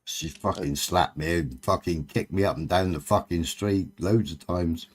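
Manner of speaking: ordinary talk, nothing marked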